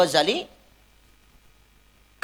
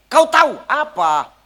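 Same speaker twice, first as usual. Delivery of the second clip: put-on old woman scolding; 4 semitones higher, 5 dB louder than usual. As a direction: scold the helper for dragging her back across. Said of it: "kata dia".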